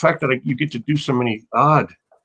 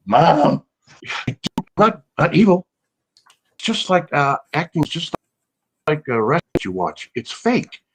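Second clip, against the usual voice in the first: excited protest; 6 semitones higher than usual. Retold or imitated. Arguing with mom about acting.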